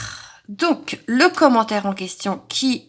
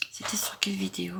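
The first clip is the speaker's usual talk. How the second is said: whisper; 3 semitones lower, 12 dB quieter, faster than usual. Real, not acted. Muttering to herself while searching.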